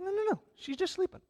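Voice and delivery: gently but firmly correct the mourners; Jesus insisting. Retold or imitated. Jesus insists to the mourners she only sleeps, high and soft. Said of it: "He's like".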